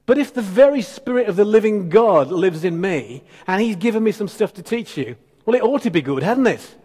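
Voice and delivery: ordinary talk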